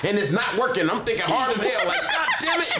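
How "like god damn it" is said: squeaked very high in mock frustration; high strained curse; frustrated. Frustrated inner voice.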